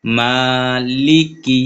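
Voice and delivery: মালিকি with the আ of মা drawn out long; slow recited মালিকি with a long first vowel; elongated madd. Shown as a demonstration. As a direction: hold the মা one full beat longer. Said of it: "এই জায়গায় এক লিপ টেনে পড়তে হবে".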